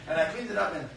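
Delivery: usual speaking voice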